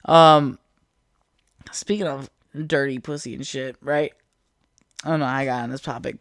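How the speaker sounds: usual speaking voice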